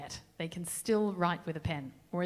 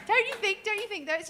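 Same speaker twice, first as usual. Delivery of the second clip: much higher, louder West Country accent; 14 semitones higher, 6 dB louder than usual. Shown as a demonstration. Singer to audience, showing off a playful West Country accent. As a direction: ham up a rural West Country lilt. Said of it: "West Country, there we go".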